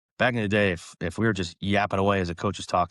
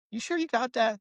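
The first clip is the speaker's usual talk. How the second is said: much higher, skeptical question; doubtful check; 14 semitones higher than usual. Retold or imitated. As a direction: ask like you don't believe him. Coach doubting player.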